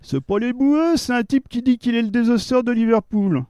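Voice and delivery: lightly voiced husband at the door. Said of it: "Je vous le mets en scène".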